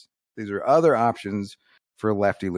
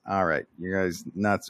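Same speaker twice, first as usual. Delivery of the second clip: normal talking voice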